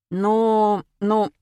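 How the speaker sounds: stretched, searching mumble of a slow thinker; drawn-out hesitation. A narrator's reading. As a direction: drag each word out while thinking. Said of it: "протянул Коля". (тянуть в раздумье)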